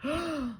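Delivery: sharp high gasp; gasp of awe. Acted. Jim amazed at the marble, sharp gasp. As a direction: gasp at the prize.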